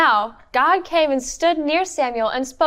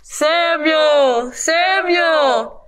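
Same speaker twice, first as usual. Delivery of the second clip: a bit louder, lightly voiced God call; 3 dB louder, much slower than usual. Storyteller voices God calling Samuel, drawn out. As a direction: call out slowly from above. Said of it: "God called it out".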